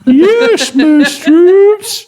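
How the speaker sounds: very high squeaky submissive reply; cartoon-high eager submission; falsetto yes mistress. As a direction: squeal like a giddy servant.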